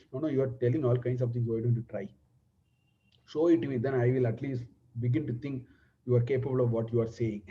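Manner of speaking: casual dismissive reply. Retold or imitated. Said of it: "Lakshmana is very casually saying".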